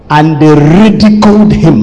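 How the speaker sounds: usual speaking voice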